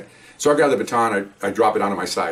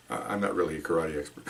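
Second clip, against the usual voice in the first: sheepish confession; 6 semitones lower, 11 dB quieter than usual. Retold or imitated. Suspect sheepishly confesses, head down.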